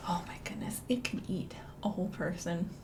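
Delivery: hushed unvoiced gasp of mock fright; mock scared whisper. Librarian spooking children about the snake, whispered.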